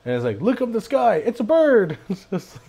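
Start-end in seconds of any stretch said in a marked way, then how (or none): 0.4-2.0 high-pitched retold exclamation; old-show bystander, raised voice; excited onlooker. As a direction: point it out in mock excitement. Imitates excited Superman show onlooker.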